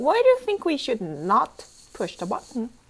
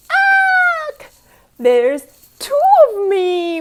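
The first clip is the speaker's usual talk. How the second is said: high, loud startled cry; shocked cry; 13 semitones higher, 8 dB louder, slower than usual. Acted. Larry alarmed to find two of himself.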